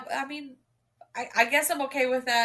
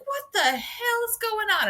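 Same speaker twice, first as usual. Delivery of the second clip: outraged reaction; 14 semitones higher than usual. Retold or imitated. Reader reacting to the hero's scheme. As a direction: shriek of disbelief. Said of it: "I was like".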